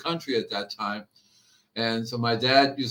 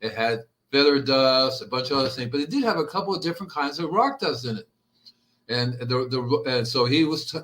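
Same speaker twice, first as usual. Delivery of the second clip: ordinary talk, nothing marked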